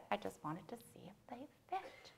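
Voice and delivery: soft, secret whisper; whispered punchline. Acted. Poet delivering the poem's ending to the audience, hushed whisper. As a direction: whisper the last line like a secret.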